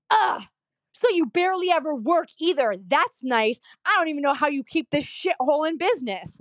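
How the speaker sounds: imitating a fed-up, scornful patient; disgusted sneering. Patient insults the office before walking out, mock disgusted rant.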